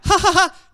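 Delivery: high, loud, hammed-up belly laugh; booming pretend cackle; loud mock laugh. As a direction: laugh out loud as Sara would not dare. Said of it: "¿Fuera?".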